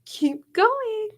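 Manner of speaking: very high, teasing; amused mock surprise. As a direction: tease them while eating popcorn. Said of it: "and be like".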